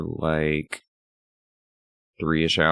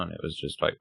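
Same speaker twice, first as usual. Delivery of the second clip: ordinary talk, nothing marked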